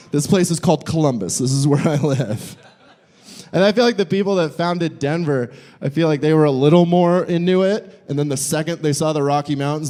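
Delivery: ordinary talk, nothing marked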